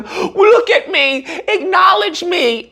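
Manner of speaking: high, slow, whiny plea for attention; mock whiny victim. Mocking party members who want to be seen as victims, in a whiny voice. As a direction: whine like a child begging to be noticed. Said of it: "constantly wants to be seen as a victim".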